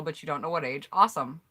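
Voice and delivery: ordinary talk, nothing marked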